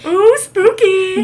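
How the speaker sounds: hammed-up spooky ooh; mock spooky. Playful reaction to Halloween episode.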